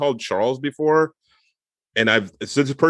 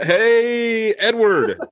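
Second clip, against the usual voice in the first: enthusiastic greeting; 11 semitones higher, much slower than usual. Retold or imitated. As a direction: greet him with mock enthusiasm. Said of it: "if you say it with enthusiasm".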